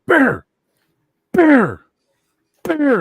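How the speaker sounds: high, loud repeated call; urgent calling out. Retold or imitated. Bouncer calling to his brawling coworker from behind, urgent shout. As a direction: call his name sharply, pausing between calls.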